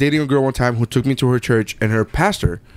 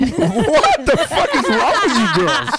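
laughing; 11 semitones higher, slower than usual. Real, not acted. Glue on hands.

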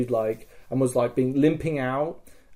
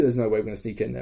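ordinary talk, nothing marked

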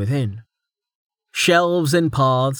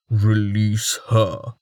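slow, deep, menacing command; low and dangerous, slow; deep threatening; much slower than usual. Acted. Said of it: "deep and bristling with threat".

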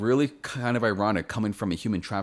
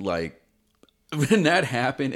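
usual speaking voice

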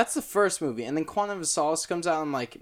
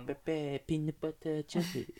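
soft, groveling mumble in imitation; Max; 8 dB quieter than usual. Repeating the impression after a tease, soft groveling mock mumble. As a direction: mimic your friend with mushy, half-swallowed words. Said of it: "You captured his groveling perfectly".

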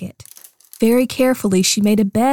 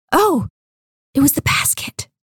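breathy whispered discovery; 5 semitones higher than usual. Acted. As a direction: hush it like a secret.

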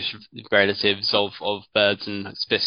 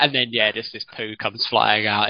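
normal talking voice

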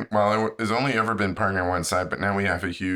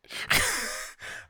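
breathy high-pitched laugh; 4 dB quieter than usual. Real, not acted. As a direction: burst out in a squeaky laugh. Laughing at naked situation.

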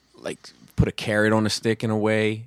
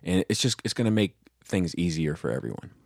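ordinary talk, nothing marked

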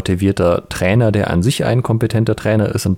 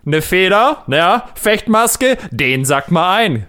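police voice; 7 semitones higher, slower than usual. Acted. Sketch: second policeman.

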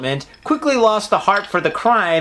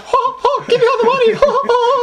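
very high squeaky cartoon mouse, louder; Mickey Mouse voice; 4 dB louder than usual. Robber's demand done as a cartoon mouse, very high squeak. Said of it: "maybe it's like Mickey Mouse".

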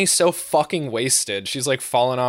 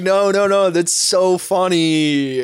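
put-on drunk giggling; 5 semitones higher, 6 dB louder, slower than usual. Drunk friend insisting, laughing.

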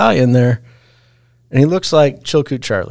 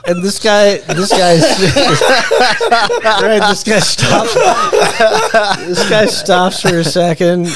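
laughing; 8 semitones higher than usual. Real, not acted. Cracking up retelling the punchline.

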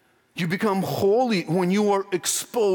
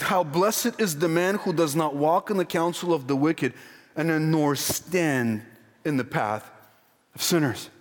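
solemn scripture reading; 4 semitones lower than usual. Acted. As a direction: savour each phrase of the verse. Reads verse one slowly.

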